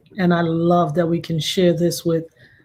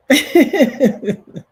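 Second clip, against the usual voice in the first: high, loud burst of giggles; real high-pitched cackling laugh; hearty laugh; 8 semitones higher, 7 dB louder than usual. Real, not acted. Host laughs while celebrating Kayla, hearty laugh.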